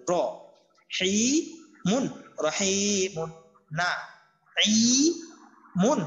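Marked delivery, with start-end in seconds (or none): 0.1-2.0 each syllable said alone with pauses between র, হি and মুন; broken syllable reading. Shown as a demonstration. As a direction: split রহিমুন into র, হি, মুন slowly. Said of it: "একটা একটা করে উচ্চারণ করবেন". (ভেঙে ভেঙে উচ্চারণ)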